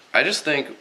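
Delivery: normal talking voice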